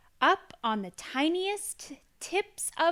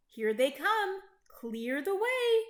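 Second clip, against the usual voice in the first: raised voice building to a high call; 7 semitones higher, 4 dB louder than usual. A narrator's reading. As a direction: warn everyone the racers are coming. Horse calls out during race.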